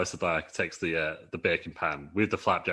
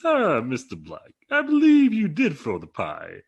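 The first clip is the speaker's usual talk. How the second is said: high, loud, slow accusing voice; Keith Lee impression; 7 semitones higher, 8 dB louder, slower than usual. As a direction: draw it out grandly like Keith Lee. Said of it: "Keith Lee goes".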